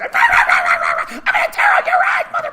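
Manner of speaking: be a furious tiny dog; yappy little dog. Retold or imitated. Teacher voicing a small dog threatening, high loud shriek. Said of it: "the way every little dog".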